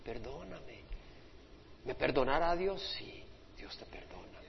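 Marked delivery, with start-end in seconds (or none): 0.0-0.8 soft, slow begging for forgiveness; penitent's hushed, slowed prayer for pardon; tearful plea. Acted. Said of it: "Y con lágrimas dices". 3.0-4.4 whispered promise of forgiveness; whispered reassurance. Acted. Reassuring the repentant sinner.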